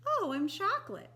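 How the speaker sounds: high, loud, delighted cupcake voice; a cupcake that just found out its flavor; bright squeal of discovery from a cupcake; cheery cupcake. Acted. Cupcake voiced in picture book.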